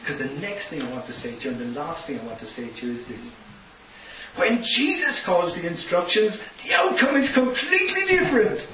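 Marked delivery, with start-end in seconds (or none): 4.4-8.6 high, loud preacher's proclamation; a preacher raising the climax to the congregation; preaching at full force. Acted. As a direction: proclaim it to the back of the hall.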